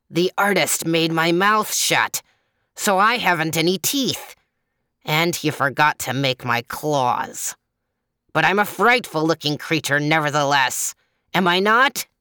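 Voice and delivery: lightly voiced leopard with emphatic snarl. A narrator's reading. Toothless leopard still claiming to look frightful, mildly snarling. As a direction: play a put-out leopard, stressing each grievance. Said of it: "snarled the leopard".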